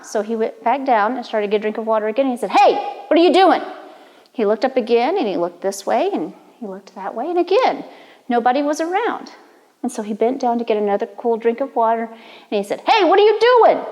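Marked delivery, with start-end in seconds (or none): 2.5-3.6 loud, higher call; hey, what are you doing, louder. Acted. Crawdad calls to the fox. 12.9-13.8 much higher, louder shout; annoyed shout from the creek; loud call. Acted. Crawdad calls louder again.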